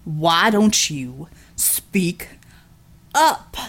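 a big thug taunting her to speak up. Acted. Villain's mocking voice.

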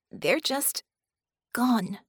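grieving. A narrator's reading. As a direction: break the sentence with a stunned pause. Bella in tears reports a loss.

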